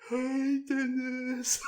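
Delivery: high, teasing hail; mock girlish call-out. Girl in his class greeting Dennis, mock high call. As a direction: imitate a classmate calling out to him.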